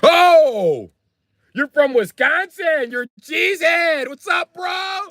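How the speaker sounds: high, drawn-out, overexcited cop greeting. Retold or imitated. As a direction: gush slowly in a high caricature voice.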